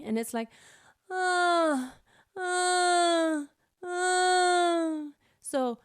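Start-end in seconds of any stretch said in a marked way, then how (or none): 1.1-5.0 higher whiny uh sounds; whining uh. Shown as a demonstration. Coach imitating the whining sound. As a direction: whimper the syllables. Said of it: "kind of whining".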